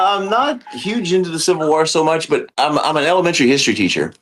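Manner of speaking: normal talking voice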